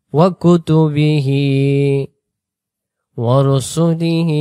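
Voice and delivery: slow, drawn-out chanted Arabic recitation. Shown as a demonstration. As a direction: intone each word slowly and reverently. Recitation with music. (ধীর সুরেলা তিলাওয়াত)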